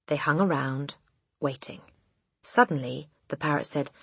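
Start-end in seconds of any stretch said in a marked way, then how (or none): none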